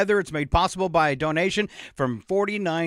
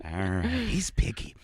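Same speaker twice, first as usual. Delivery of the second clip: whispered aside; 9 semitones lower, 6 dB quieter than usual. Real, not acted.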